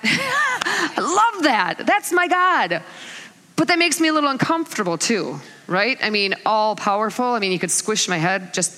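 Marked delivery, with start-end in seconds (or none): 0.0-2.8 high, drawn-out gleeful praise; delighted. Real, not acted. Preacher exulting in an all-powerful God, delighted. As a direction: gush with proud delight.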